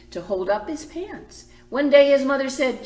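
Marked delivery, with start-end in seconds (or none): none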